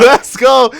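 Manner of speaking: high, loud hype shout; thrilled caster outburst; excited cheer. Real, not acted. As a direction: cheer the surprise play at full voice. Cheering a surprise play.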